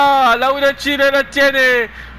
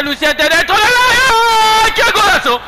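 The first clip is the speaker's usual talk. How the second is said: excited commentary; 8 semitones higher than usual. Real, not acted. Goal chance, excited commentary.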